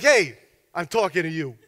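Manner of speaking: put-on Chicago Italian gangster voice; Italian gangster accent. Thug repeating himself to the pastor, Italian gangster accent. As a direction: play a twenties Italian-American gangster. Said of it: "accent of an Italian gangster in Chicago".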